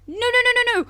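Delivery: usual speaking voice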